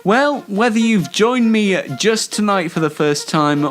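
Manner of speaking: normal talking voice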